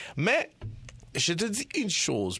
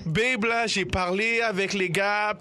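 put-on high, slow, pleading boyfriend; 6 semitones higher, 3 dB louder, slower than usual. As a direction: play the boyfriend, higher and louder. Acting out the boyfriend talking.

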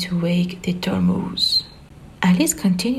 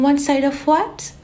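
much higher, calling out; called after him; 7 semitones higher than usual. Acted. Alice calling after the caterpillar, high and puzzled. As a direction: call after the departing caterpillar. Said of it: "Alice called after him".

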